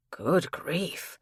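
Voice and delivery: whispered shock under the breath; a stunned exclamation half to himself; shocked gasp. Acted. Patrick reads the threatening letter, whispered shock.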